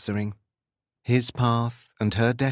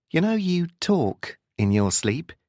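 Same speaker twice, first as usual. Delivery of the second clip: higher, lightly voiced woman in dialogue; woman character, teasing; 7 semitones higher than usual. A narrator's reading. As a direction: lightly play a curious woman.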